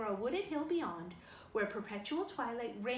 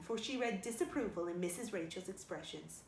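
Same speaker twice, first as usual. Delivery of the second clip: ordinary delivery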